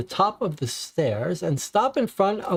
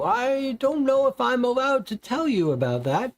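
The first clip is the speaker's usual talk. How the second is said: salesman voice; 8 semitones higher than usual. Acted. Salesman hesitates to share.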